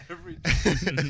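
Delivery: said through laughter; laughing. Real, not acted. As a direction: laugh the words out. Laughing while repeating.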